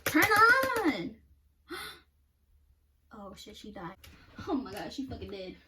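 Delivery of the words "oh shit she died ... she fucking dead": ordinary talk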